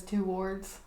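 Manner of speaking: "towards" in two syllables, too-WARDS; imitated pronunciation. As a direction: say "towards" with a full TOO at the start. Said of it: "the way she says".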